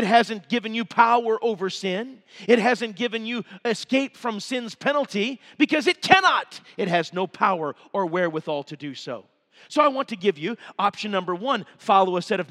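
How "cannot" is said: cannot. hit high and loud; ringing emphasis on cannot; emphatic stress. Real, not acted. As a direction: punch cannot. hard and high. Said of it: "as emphatically and clearly as I can".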